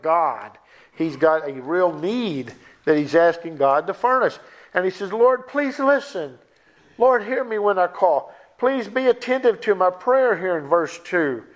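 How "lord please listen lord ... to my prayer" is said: raised-pitch entreaty to God; imitated earnest prayer; pleading. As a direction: plead like the psalmist, higher and unhurried. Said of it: "Lord, he's pleading".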